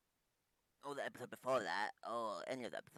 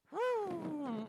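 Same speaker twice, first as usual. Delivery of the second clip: whew; 4 dB louder than usual. Real, not acted. Reacting to sad game scene.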